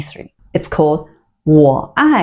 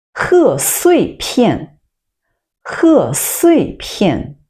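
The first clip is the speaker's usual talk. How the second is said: slow teaching demo; 5 semitones higher, much slower than usual. Shown as a demonstration. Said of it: "repeats new word slowly".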